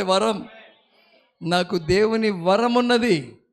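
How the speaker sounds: ordinary delivery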